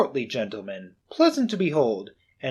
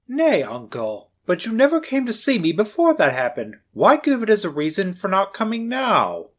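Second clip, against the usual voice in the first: higher, earnest appeal; pleading nephew; 6 semitones higher, 4 dB louder than usual. Acted. Nephew pleading with his uncle, high and earnest. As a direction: reason with him gently.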